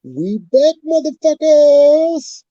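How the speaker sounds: hype shout. Real, not acted. Hype return to stream.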